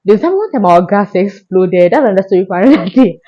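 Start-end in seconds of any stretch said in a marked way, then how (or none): none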